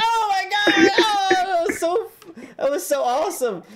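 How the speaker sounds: high excited shout, fading. Retold or imitated. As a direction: gush with delight. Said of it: "I was sitting there like".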